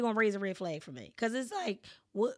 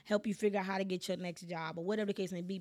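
usual speaking voice

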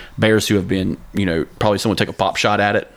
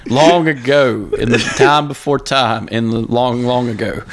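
epic fantasy narrator; 3 semitones higher than usual. Pretend. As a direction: tell it like an ancient myth. Mock legend opening.

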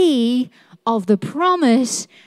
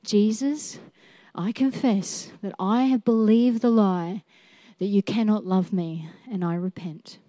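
soft, quiet, earnest prayer; preacher modelling a soft prayer of confession; model prayer; 2 semitones lower, 6 dB quieter than usual. Shown as a demonstration. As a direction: pray it gently as an example for them. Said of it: "Would you like to know how?".

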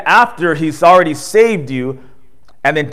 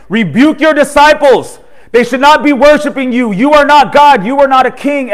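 higher, louder, indignant rebuke; indignant Pharisees; 9 semitones higher, 5 dB louder than usual. Retold or imitated. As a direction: scold him like an offended Pharisee. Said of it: "the Pharisees are saying".

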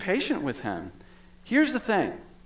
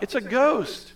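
high-pitched cry of fear, \"ghost\" falling; frightened cry; 9 semitones higher, slower than usual. A narrator's reading. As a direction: disciples crying out in fear from the boat, a mild scared cry. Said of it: "In their fear, they cried out".

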